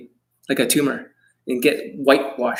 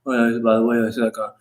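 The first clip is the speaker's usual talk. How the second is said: mock slurring; 3 semitones lower than usual. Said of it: "slurring his fucking words".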